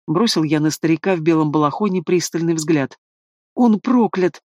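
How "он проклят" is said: higher, lightly voiced scared healer; frightened bleat. A narrator's reading. Old healer tells son of the curse, frightened bleat. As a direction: bleat it out in alarm. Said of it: "заблеял лекарь, испуганно стреляя глазами".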